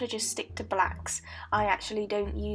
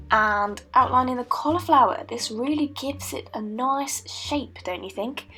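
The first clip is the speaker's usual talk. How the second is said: ordinary delivery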